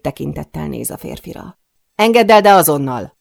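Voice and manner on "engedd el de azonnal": loud, raised-pitch order; angry command. A narrator's reading. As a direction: demand release at once. Viktor orders man to release her. (dühös, hangos parancs)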